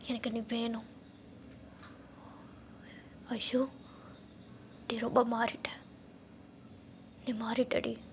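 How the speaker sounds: soft, low, with long pauses; hushed and wistful; tender plea. Acted. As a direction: murmur it intimately to a lost love. Intimate plea to former love.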